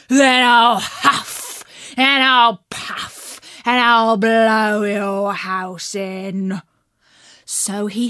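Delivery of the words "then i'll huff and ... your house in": slow, loud menacing growl; big bad wolf threatening.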